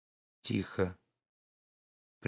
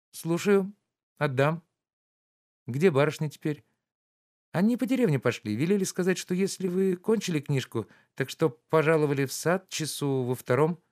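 higher, lively maid's voice; young maid; 7 semitones higher than usual. Acted. Katya the maid brings the young lady's question, brightly. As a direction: chirp it like an eager young maid.

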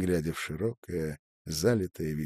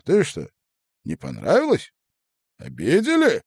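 worried, high and loud questions; concerned questioning; 10 semitones higher, 8 dB louder than usual. A narrator's reading. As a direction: press them with anxious concern. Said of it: "Он озабоченно заглянул ей в лицо". (озабоченно, с громкими вопросами)

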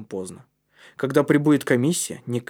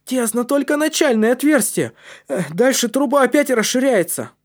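put-on high, loud, thrilled boy's voice; joyful shout; 12 semitones higher, 5 dB louder than usual. A narrator's reading. Матиас tells those below the pipe widens, elated. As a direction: shout the good news back. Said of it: "крикнул он радостно".

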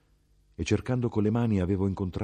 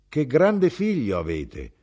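slow, raised exclamation of wonder; exclaiming; 8 semitones higher, 6 dB louder, slower than usual. Acted. Peasant woman admiring the visitor's son, exclaiming. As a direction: marvel loudly at the tall son. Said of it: "ed esclamò".

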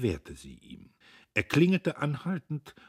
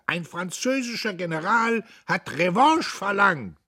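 high, overstrained yell; cracking shout; 12 semitones higher, 8 dB louder than usual. A narrator's reading. Dietrich shouts over applause, voice straining to cracking. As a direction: shout on though the voice tips over. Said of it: "schrie weiter, wenn ihm auch die Stimme überschnappte".

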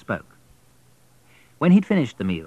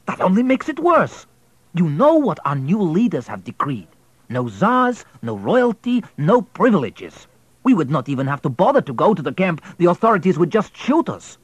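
raised, higher, urgent man's voice; louder, pressing the wife to get rid of him; a husband insisting in a raised voice; Pyotr, urgent husband; 8 semitones higher, 5 dB louder than usual. Acted.

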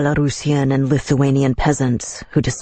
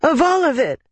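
very high, slurred cry; high drugged cry; 13 semitones higher than usual. A narrator's reading. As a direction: cry it out, half asleep. Drugged mother cries out.

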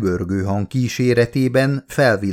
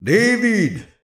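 high call stretched toward a distant figure; 11 semitones higher than usual. A narrator's reading. Holloway calling to the distant robot, high shout. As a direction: shout the name across the cave. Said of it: "kiáltott Holloway is".

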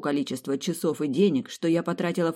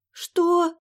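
very high shocked squeak; 13 semitones higher, 4 dB louder than usual. Acted. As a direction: jolt up in disbelief. Shocked at being the bride.

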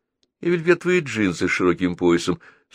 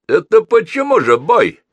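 high, loud challenge; shouted question; 12 semitones higher, 8 dB louder than usual. A narrator's reading. Mercenary challenging the boy, shouted. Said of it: "крикнул кто-то".

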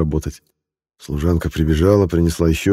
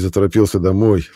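usual speaking voice

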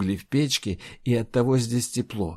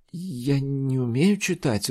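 timid; 5 semitones higher, slower than usual. Acted. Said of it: "робко возразила Жанники".

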